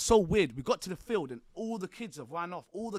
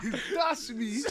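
higher and louder, laughing through it; 7 semitones higher, 6 dB louder than usual. Real, not acted. Telling his friend how he got slammed onto a car, laughing. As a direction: laugh at your own bad luck.

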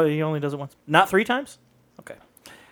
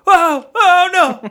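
high, loud fake wail of alarm; mock dismay; 8 dB louder, much slower than usual. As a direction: cry out in hammed-up horror. Acting out a feigned accident.

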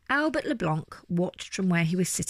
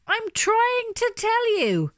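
high, slow, emphatic outburst; exasperated shouting; 13 semitones higher, 3 dB louder, slower than usual. A narrator's reading. Bear never allowed to finish his sentence, exasperated shouting. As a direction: cry out each word slowly and high. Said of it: "he shouted".

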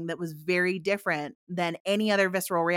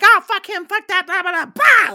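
much higher, loud mock explosion; exploding ego; 12 semitones higher, 10 dB louder than usual. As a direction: blow up in a hammed-up rant. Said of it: "you're like".

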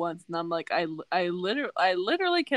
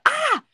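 high, loud startled shriek; mock fright scream; retold scream; 9 dB louder than usual. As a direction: shriek as if a bug landed on you. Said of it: "and I screamed".

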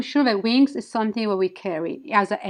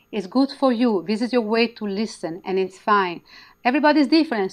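ordinary talk

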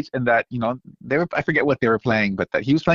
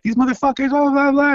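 high, pitched-up mock rant; venting in a raised voice. Marta ranting backstage about the crowd, high mock anger. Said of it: "And Marta's like".

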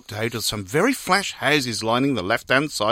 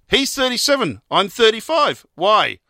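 higher, light wife voice; 7 semitones higher than usual. Acted. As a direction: answer like a chirpy wife. Wife voiced in read joke.

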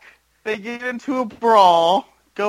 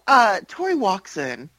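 ordinary talk, nothing marked

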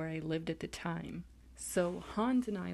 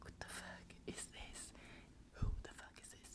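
whispered, much softer, in disbelief; shocked whisper; 14 dB quieter than usual. Retold or imitated. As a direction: hush it out in disbelief. Shocked muttered reaction to stranger.

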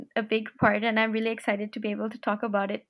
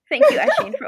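mock gratitude; 14 semitones higher, 8 dB louder, faster than usual.

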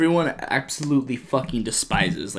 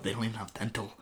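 deadpan mutter; 4 semitones lower, 15 dB quieter, faster than usual. Retold or imitated. Shrek's deadpan echo.

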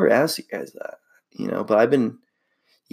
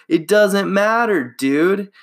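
blunt scolding; 8 semitones higher, slower than usual. Retold or imitated. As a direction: scold the bad driver straight. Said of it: "And he goes".

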